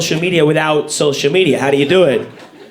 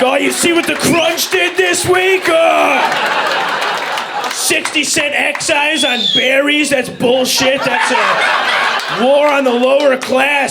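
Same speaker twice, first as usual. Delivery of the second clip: higher, shouted mock outrage, slowed; hammed-up outraged ranter; 3 semitones higher, slower than usual. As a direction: rant like an online conspiracy crank. Impersonating ranting cereal poster.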